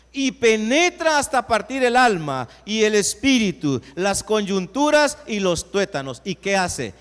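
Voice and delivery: loud preached scripture; booming pulpit reading; preaching at full force. Acted. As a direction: drive the verse home, loud. Preacher proclaims the verse.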